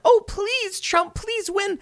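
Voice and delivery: high, whiny mock begging; mocking pleading fan. Mocking the leftists' idea of a desperate Trump fan, high whiny begging. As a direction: sneer at them with a squeaky plea. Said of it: "I'm like".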